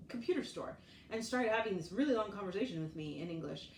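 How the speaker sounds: ordinary talk, nothing marked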